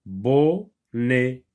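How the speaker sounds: "bonnet" slowly split bon... net; "bon", long pause, rising, then "net"; two syllables. Shown as a demonstration. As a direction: draw out "bonnet" with a long gap between syllables. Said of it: "تتكون من مقطعين".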